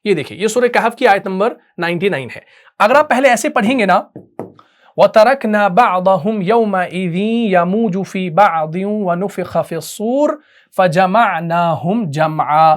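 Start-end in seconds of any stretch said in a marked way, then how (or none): 5.0-12.8 slow chanted recitation, जमआ ending with sukun on मीम; correct recitation. Shown as a demonstration. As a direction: recite slowly, keeping the मीम in जमआ silent-voweled. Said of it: "तो आपने सही पढ़ा".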